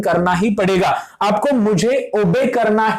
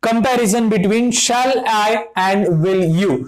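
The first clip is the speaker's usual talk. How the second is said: slow dictation; much slower than usual. Shown as a demonstration. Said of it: "अंत में हम एक चीज देखते हैं".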